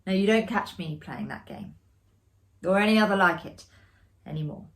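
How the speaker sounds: ordinary delivery, nothing marked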